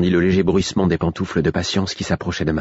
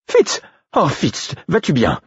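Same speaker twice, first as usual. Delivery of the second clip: voix de vieille dame plus aiguë et forte; Patience inquiète; 10 semitones higher, slower than usual. Acted. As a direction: appeler Fitz à travers la porte. Patience calls through door. (vieille dame anxieuse qui appelle)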